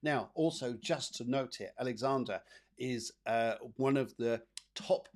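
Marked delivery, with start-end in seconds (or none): none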